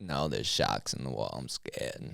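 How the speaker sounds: put-on low, soft Boston kid, scared; a frightened kid in Boston accent, trailing to unvoiced. Kid refusing to get in the car, quiet scared Boston accent. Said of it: "let's do a Boston accent".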